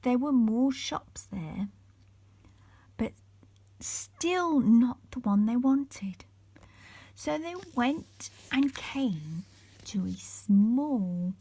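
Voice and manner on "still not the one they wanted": plain reading voice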